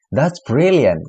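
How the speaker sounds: drawn-out ironic "brilliant" with a high start, falling; sarcastic praise. Shown as a demonstration. English teacher showing a native speaker's sarcastic reply. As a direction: fake enthusiasm that really means disaster.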